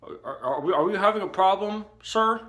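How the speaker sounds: a hint of officer questioning a suspect; police officer. Cop questions him about the chainsaw, lightly officious. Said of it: "and he's like".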